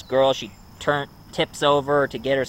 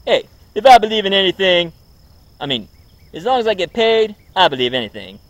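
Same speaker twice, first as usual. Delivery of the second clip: loud, brash, higher hustler voice; Eddie Murphy impression; 5 semitones higher, 6 dB louder, faster than usual. Vlogger imagining Eddie Murphy as Winston, loud brash impression. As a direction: swagger it out like a fast-talking comic. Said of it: "he'll be saying more like this".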